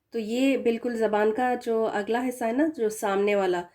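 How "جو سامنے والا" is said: usual speaking voice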